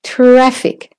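"traffic" with high TRAF, quick IC; stress on TRAF. Shown as a demonstration. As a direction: reduce the ending of "traffic". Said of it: "The I C is quick".

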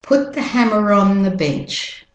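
British-Australian vowels, linking R in "hammer on"; British-Australian teacher's model sentence; British-Australian accent. Shown as a demonstration. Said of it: "doing the British Australian accent".

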